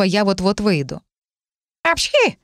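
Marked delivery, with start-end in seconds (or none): 1.8-2.4 high squeaky sneeze; cat sneeze. Acted. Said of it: "раздалось рядом голосом Морфеуса". (кошачий чих)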